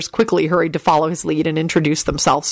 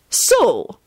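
icy headmistress opening, raised pitch; 6 semitones higher than usual. A narrator's reading. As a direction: open with cool disapproval. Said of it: "announced in an icy tone".

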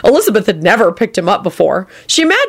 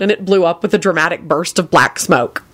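usual speaking voice

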